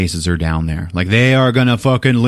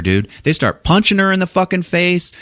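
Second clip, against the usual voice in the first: ordinary delivery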